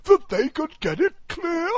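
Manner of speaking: squeaky, high and loud walrus. Acted. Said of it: "the walrus said".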